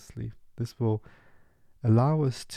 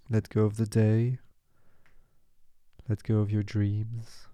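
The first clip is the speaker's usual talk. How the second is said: calm, even meditation voice with long pauses; unhurried guiding, leave space after each short phrase; guided meditation. Real, not acted. Meditation guide settling listeners into the session, calm and measured.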